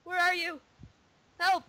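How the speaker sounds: high, loud cry for help; alarmed game shout. Real, not acted. Player calling for help as the spell lands, alarmed shout. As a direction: call out in a panic, loudly.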